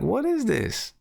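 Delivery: high-pitched mock disbelief; baffled reaction. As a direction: act out being taken aback, pitch way up. Acted baffled reaction to the laugh.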